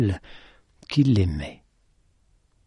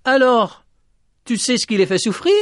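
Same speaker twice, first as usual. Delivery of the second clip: high, raised, insistent asking; shouted question; 13 semitones higher, 4 dB louder than usual. Acted. Petit Jean questions the lady about the hens, shouting.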